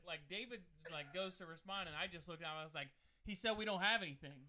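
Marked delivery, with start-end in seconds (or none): 3.2-4.4 retold reply, said loudly; raised-voice retort. Telling the stranger they have nothing, raised voice. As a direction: act out a forceful reply.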